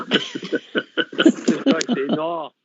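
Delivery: words stretched out by a laugh; can barely get it out for laughing. Real, not acted. Caller cracking up on the phone-in, laughing.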